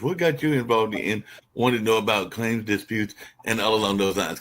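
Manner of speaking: ordinary talk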